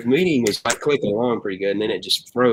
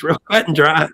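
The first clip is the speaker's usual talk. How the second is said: ordinary delivery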